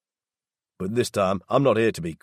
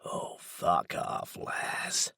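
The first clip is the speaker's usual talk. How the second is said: softer, slow hissed contempt; whispered snarl; 2 semitones lower, 7 dB quieter, much slower than usual. A narrator's reading. Daryl dismisses Laz in the dark, hushed snarl. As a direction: snarl it under the breath. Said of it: "he snarls".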